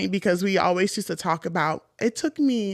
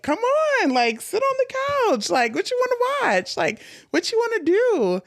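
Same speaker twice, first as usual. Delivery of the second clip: high, lilting, friendly hosts; mock sing-song welcome; welcoming family voice; 14 semitones higher than usual. Family inviting guest to the couch, high sing-song welcome. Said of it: "they were just like".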